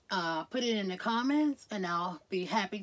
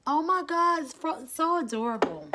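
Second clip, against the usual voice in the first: high, loud excited gush; delighted high-pitched gushing; 7 semitones higher, 6 dB louder than usual. Real, not acted. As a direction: squeal over something adorable. Gushing over her things.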